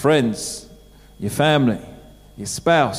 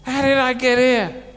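higher, whining lament in a mock moan; mock groaning lament; 6 semitones higher than usual. Believer stuck in a ditch moaning to himself, mock whine. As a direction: moan it like someone stuck again. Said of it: "We're kinda going".

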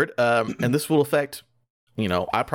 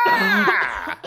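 put-on old man scream. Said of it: "screaming like the old man".

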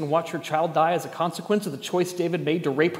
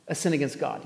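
ordinary talk, nothing marked

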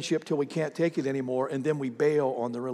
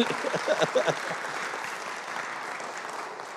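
long breathy laugh; real laughter; 8 dB quieter than usual. Real, not acted. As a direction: let the laugh run out. Laughs sending volunteer off.

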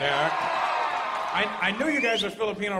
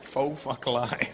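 very slow, lower Faux fuck lie, each word apart; slow and deliberate; 5 semitones lower, much slower than usual. Shown as a demonstration. Comedian savoring the pun for the crowd, very slow. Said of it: "Doesn't it sound like".

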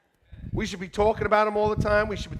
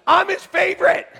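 higher, loud proud shout; the congregation's proud shout; loud declaration; 10 semitones higher, 8 dB louder than usual. Shown as a demonstration. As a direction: proclaim it to your neighbour. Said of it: "Look at the person next to you and say".

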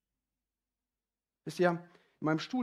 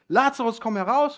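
much higher, loud commanding call; 8 semitones higher, 11 dB louder than usual. Acted. Jesus calling Lazarus out of the tomb, loud command. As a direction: call him out of the grave. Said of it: "und er ruft".

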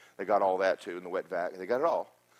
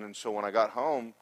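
normal talking voice

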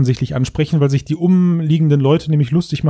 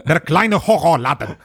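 put-on joking Russian accent in German; 3 semitones higher than usual. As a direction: lay on a fake Russian accent, laughing. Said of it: "du bist aber immer noch im russischen Akzent".

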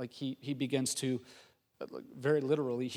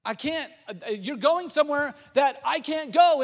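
high-pitched, distressed protest; mock panic, pitched up; freaking out; 10 semitones higher, 9 dB louder than usual. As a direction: plead as if being left behind. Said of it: "freaking out".

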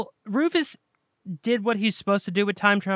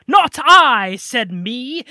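loud, high mock British accent; 5 semitones higher, 9 dB louder, much slower than usual. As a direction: declaim it like a hammy British actor. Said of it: "he's talking in this British accent".

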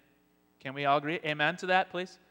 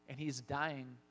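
ordinary talk

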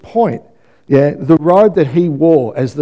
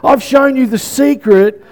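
fervent preaching; 7 semitones higher than usual. Acted. Preacher proclaiming Jesus' words to the congregation, fervent. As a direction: build each line like a declaration.

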